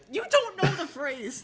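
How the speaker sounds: high, slow mock indignation; mock outrage. Fan to someone who missed the phrase, mock outrage. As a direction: scold them in exaggerated disbelief, drawing it out.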